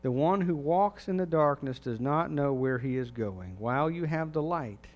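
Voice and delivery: loud, full-voiced Bible reading; scripture reading. Preacher reads the Gospel passage aloud to the church, proclaiming.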